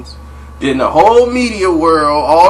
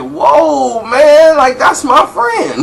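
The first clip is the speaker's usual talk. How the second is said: much higher, louder and slow; startled Mike; 11 semitones higher, 7 dB louder, much slower than usual. Retold or imitated. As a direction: caught off guard, pulling back.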